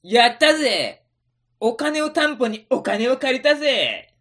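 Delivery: loud, high triumphant cheer. Acted. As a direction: celebrate the win. Celebrating success.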